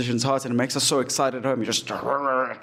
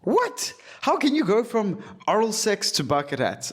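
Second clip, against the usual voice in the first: baffled; 8 semitones higher than usual. Real, not acted. Baffled by bucket hat question.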